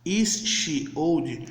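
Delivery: slow, carefully spaced model question; slow demo English. Shown as a demonstration. Teacher to students learning English, slow and deliberate. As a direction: spell it out slowly for beginners. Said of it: "imagine que em vez de falar".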